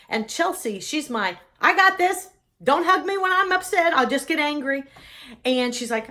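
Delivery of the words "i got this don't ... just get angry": high, loud, defensive snap; upset outburst. Acted. Upset daughter refusing a hug from family, defensive outburst. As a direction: push them away, loud and high-pitched.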